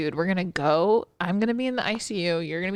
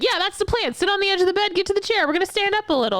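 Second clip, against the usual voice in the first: high sing-song nurse; 11 semitones higher, faster than usual. Retold or imitated. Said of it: "And you're like".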